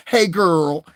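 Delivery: high, loud mock girly voice; mocking effeminate greeting. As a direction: ham it up like a mincing man. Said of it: "even the men sound like bitches".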